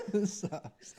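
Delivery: laughing. Real, not acted. Laughing while in pain.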